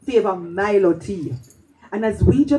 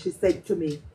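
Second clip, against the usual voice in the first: normal talking voice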